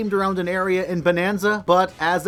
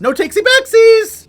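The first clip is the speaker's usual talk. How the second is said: much higher, loud kiddie yell; childish shout; 11 dB louder than usual. Acted. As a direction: squeal it like a gloating kid. Childish gloating outburst.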